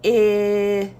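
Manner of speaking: I as a long level "ihhh"; isolated vowel sound. Shown as a demonstration. Teacher showing first sound of igloo, I held as "ihhh". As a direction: hold I as a short-i vowel, not the letter name. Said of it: "The first sound is".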